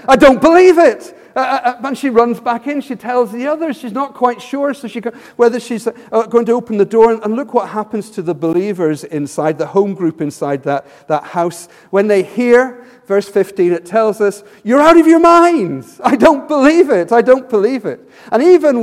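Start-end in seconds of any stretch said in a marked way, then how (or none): 0.1-1.1 loud, high burst of astonishment; amazed disbelief. Acted. Preacher voicing Rhoda finding Peter at the door, amazed. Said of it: "She's so amazed that Peter's there". 14.6-18.1 loud, high dismissal easing slightly on repeats; incredulous outburst. Acted. Preacher voicing believers who doubt Rhoda's news, incredulous. Acted disbelieving believers inside the house.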